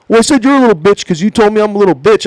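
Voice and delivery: high, fast, argumentative imitation; mock defensive retort. Trainer justifying his insult to trainee, mock defensive. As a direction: rattle it off as a childish comeback.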